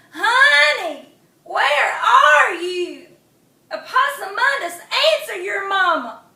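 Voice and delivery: high, loud, slow mother's call. Acted. Mama calling her lost son, loud and high. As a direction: call across the swamp for your child.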